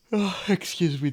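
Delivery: talking through a yawn; words stretched by a yawn. Real, not acted. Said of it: "just big yawn".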